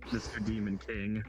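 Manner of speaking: ordinary talk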